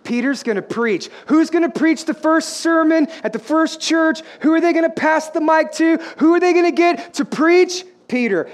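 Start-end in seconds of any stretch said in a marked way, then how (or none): 1.2-7.8 high, rising preacher's questions; fired-up preaching. Real, not acted. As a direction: build up the crowd with raised voice. Preaches at full force.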